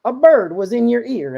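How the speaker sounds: very high, loud cartoon character; storybook guy voice. Shown as a demonstration. Dad voicing the guy who finds the bird, high loud cartoon voice. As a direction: call it out in a big squeaky put-on voice. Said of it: "and the guy goes".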